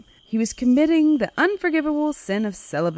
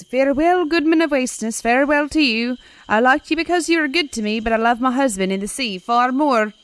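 higher, louder proud farewell; selkie's parting words; 6 semitones higher, 3 dB louder than usual. Acted. The selkie bids her human husband farewell, high carrying call. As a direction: call it out from the waves, raised and loud.

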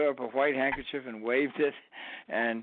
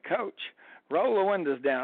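lightly put-on Alabama accent; 7 semitones higher than usual. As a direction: imitate a charming Alabama player calling to his coach. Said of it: "Alabama accent".

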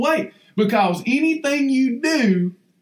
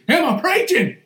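the question thrown again, higher; a playful higher echo; higher repeat; 5 semitones higher than usual. Real, not acted. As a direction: ask it again, higher and teasing.

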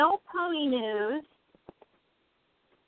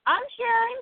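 playful; 12 semitones higher, 3 dB louder than usual. Real, not acted. Teasing about eating ice cream.

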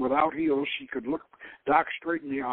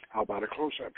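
soft, low murmur; 7 semitones lower, 7 dB quieter, faster than usual. A narrator's reading. Woman teasing the man beside her, soft murmur. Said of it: "she murmured".

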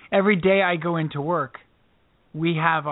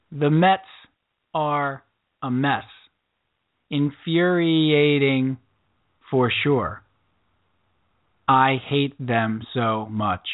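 slow, deliberate haiku recital; measured poem reading; reciting a haiku; much slower than usual. Acted. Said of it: "You do a sports haiku. Go".

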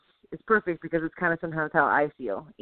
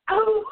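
high, loud, long howl; wolf howl; 5 dB louder than usual. Retold or imitated. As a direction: howl like a tiny victorious wolf. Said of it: "like a wolf, just howling to the night".

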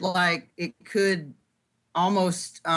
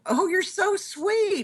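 wife's quote; 11 semitones higher, slower than usual. Retold or imitated. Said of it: "she'll look at me and go".

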